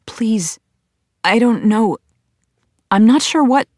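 halting plea, pitch rising high; stammering. A narrator's reading. Narrator begging the grieving woman to stop, stammering. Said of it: "I stammer".

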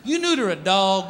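usual speaking voice

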